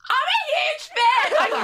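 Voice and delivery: very high, loud, drawn-out fan squeal; starstruck yell. Retold or imitated. Guest re-enacts meeting her idol, starstruck yell. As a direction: re-enact blurting it out far too loudly. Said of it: "I yelled it at her".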